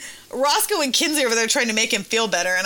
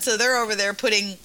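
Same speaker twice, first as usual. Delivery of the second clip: ordinary delivery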